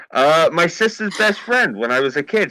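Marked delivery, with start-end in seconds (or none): none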